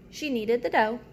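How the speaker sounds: ordinary delivery, nothing marked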